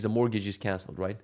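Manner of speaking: ordinary talk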